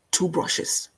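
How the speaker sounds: "two brushes" breathed as a whisper. Acted. Host teasing viewers, whispering "two brushes". Livestream host's brush metaphor.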